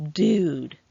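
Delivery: put-on drawn-out snobby Valley Girl "Dude" with a nasal twang. Michael gushing at the bar in a snobby nasal Valley Girl voice. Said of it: "he said in his snobby, nasal Valley Girl voice".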